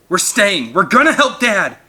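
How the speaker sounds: high, loud determined cry; defiant shout. A narrator's reading. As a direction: refuse to leave, shouting. Boy defiantly refuses to flee.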